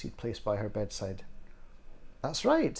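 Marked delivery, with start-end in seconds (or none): 2.2-2.8 high, pleased confirmation; pitched up with pride; proud reply. A narrator's reading. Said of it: "he replied proudly".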